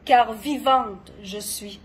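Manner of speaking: dramatic poetry reading with long pauses; poem recitation. Acted. Poet performing own poem on gentle madness, dramatic recitation. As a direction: recite each line deliberately, swelling and falling in intensity.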